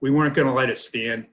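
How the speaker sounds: normal talking voice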